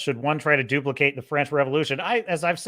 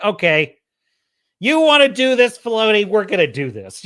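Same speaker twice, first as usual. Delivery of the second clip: mock challenge; 4 semitones higher, 7 dB louder than usual. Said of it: "I feel like".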